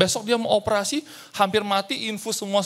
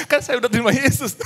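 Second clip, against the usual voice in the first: laughing; 7 semitones higher, faster than usual. Real, not acted. Laughs at the man's request.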